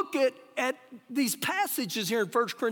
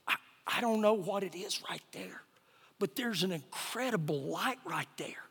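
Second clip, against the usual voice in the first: halting, soft, low imitation of amazement; awed hush; 5 semitones lower, 7 dB quieter than usual. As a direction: drop toward a whisper, as if seeing something holy. Man points at light, awestruck.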